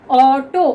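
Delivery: ऑटो slow, open aw at the start; auto with aw. Shown as a demonstration. Teacher modelling the correct reading slowly. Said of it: "अगर हम इसको ऑटो पढ़ेंगे ना, वो गलत होता है".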